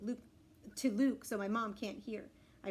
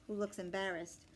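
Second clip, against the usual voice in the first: ordinary delivery, nothing marked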